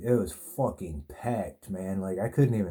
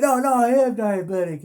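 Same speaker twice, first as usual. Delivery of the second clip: much higher, louder whiny protest; mock drunk insisting; 13 semitones higher, 8 dB louder than usual. Drunk inmate insists to the cops he is diabetic, high loud whine. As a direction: ham up a drunk insisting he's sick. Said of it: "he's like".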